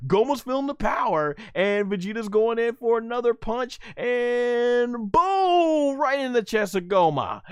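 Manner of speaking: high, building fight commentary; excited play-by-play. Real, not acted. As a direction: commentator riding the big hit. Play-by-play of a big punch.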